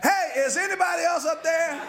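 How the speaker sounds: high-pitched comic plea for someone else; calling out. Acted. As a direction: call past the first voice, punchline delivery. Trapped man calls for another rescuer.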